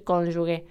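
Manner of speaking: cónyuge ending in a hard gue; common mispronunciation. Shown as a demonstration. Students still falling into the error, imitated mispronunciation. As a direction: say cónyuge with g as in gato. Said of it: "caían en el error de decir".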